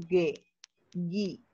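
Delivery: "Ge, gi" with hard g and no w glide; silent U, hard g. Shown as a demonstration. Teacher shows how mute U sounds, "Ge, gi" with hard g. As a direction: say "Ge, gi" like English get and give. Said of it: "me ayuda a que suene así".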